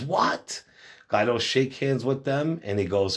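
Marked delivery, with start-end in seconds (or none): 0.0-0.6 sharply higher, startled What?; a jolt of disbelief; retold shocked exclamation. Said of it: "Gaito goes".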